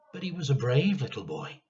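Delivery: usual speaking voice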